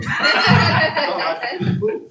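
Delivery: high sustained laugh; a helpless run of high laughter; real laughter. Real, not acted. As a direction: crack up at your own joke. Comedian cracks up at own joke.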